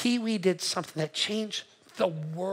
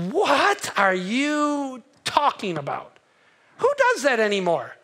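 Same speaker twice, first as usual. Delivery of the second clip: mock dismissal; 4 semitones higher, 3 dB louder, slower than usual. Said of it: "here's what you do".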